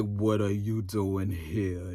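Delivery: very slow, low, soft drawl; exaggerated sluggish imitation; mocked drawl. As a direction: drag it out low like he's drugged. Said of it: "He sounds like he's drugged".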